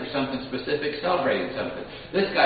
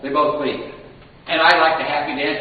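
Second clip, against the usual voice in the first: ordinary delivery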